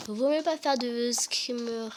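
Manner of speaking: normal talking voice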